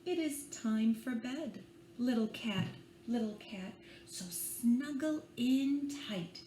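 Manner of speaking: put-on gentle read-aloud for small children; bedtime story reading. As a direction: lull them along with the rhyme. Reads picture book aloud.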